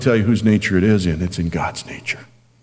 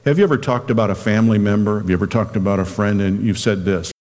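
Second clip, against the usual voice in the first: ordinary talk, nothing marked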